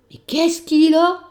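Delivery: loud, high, slow shout; shouting over noise. Acted. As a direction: yell over a screaming baby. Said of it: "Elle est obligée de crier".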